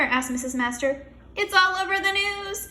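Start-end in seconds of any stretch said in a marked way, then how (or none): 1.3-2.7 high, loud alarm; teacher shouting in a panic; panicked shout. Acted. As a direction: burst in shouting the bad news. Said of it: "shouted Mister Klutz".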